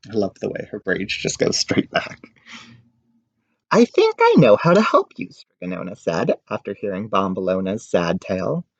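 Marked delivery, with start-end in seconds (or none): 3.7-5.3 put-on high, louder kindly old woman; old witch voice. Witch offering help to a sad girl, in a high kindly voice. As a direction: offer help like a kind grandmother.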